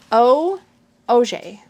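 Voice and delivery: "O", pause, then "[name]" as oh-ZHAY; broken-down French pronunciation. Shown as a demonstration. Reader practising the surname, "O" first, then "[name]" as oh-ZHAY. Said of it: "My surname [name] is pronounced".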